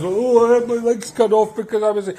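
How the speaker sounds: high, loud whining parody; mock the protester's whimper; whiny mock protester. Mocking protester dragging himself along, whiny. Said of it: "mocking legless protester".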